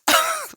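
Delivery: very high short squeal of laughter; squeaky laugh. Real, not acted. Coach laughing at people smashing their own hands, high squeal.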